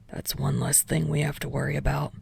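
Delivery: Dynamite thinking to himself. A narrator's reading. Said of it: "Dynamite thought to himself".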